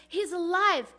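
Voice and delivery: joyful news. Acted. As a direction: announce it with jubilant relief. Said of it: "think of the joyous day".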